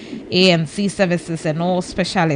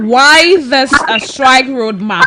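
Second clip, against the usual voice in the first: raised voice; 5 semitones higher, 8 dB louder than usual. Real, not acted. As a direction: project so the caller hears. Repeating question louder for caller.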